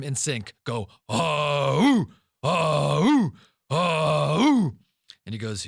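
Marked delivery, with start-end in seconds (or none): 1.1-4.8 loud rhythmic high ah-ooh battle chant; war chant. Retold or imitated. Spartan soldiers answering their leader, roaring war chant. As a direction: chant it like an army in unison.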